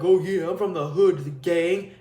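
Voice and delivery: mock a wannabe tough guy; mock tough talk. Mocking wannabe gangsters who act all tough, lightly voiced boast. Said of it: "they act, like, all tough".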